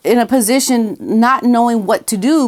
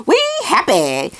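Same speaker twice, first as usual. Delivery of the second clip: laughing fake cheer; 6 semitones higher than usual. Fake happy couple, laughing.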